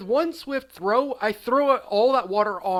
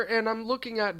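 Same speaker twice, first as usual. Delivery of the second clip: ordinary delivery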